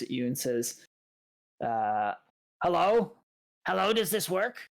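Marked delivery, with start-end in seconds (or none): 1.6-4.7 small tinny construct voice, testing itself; a little machine checking its speaker. Acted. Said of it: "in a tinny little voice".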